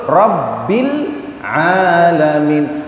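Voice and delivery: "alamin" chanted with the a held two counts; correct recitation. Shown as a demonstration. Teacher shows the right length, chanted. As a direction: stretch the "a" of "alamin" for exactly two beats. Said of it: "Yang betul".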